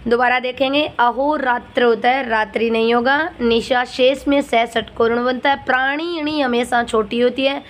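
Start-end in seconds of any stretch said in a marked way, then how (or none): none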